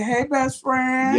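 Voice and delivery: high, drawn-out sing-out hello; excited greeting. Retold or imitated. Greeting a friend walking up to the table, excited. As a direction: re-enact hailing a favorite friend.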